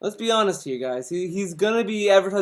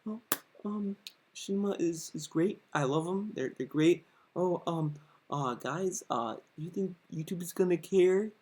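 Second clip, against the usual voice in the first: mock calmed down; 2 semitones lower, 12 dB quieter than usual. Commentator mimics streamer after the tantrum, meek and calmed down. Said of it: "now that he calmed down".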